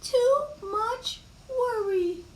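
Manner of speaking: very high, drawn-out granny voice; slow grandmotherly lament; fretting grandmother. Acted. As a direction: stretch each word up high. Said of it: "said her grandmother".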